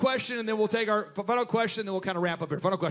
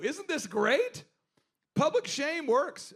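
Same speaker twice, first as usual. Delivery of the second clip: savoring; 6 semitones higher, much slower than usual. Real, not acted. Gleeful aside to audience.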